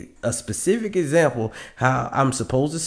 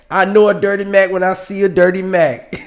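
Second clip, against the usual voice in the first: loud call-out; 9 semitones higher, 8 dB louder than usual. Real, not acted. Host riled up calling out guest.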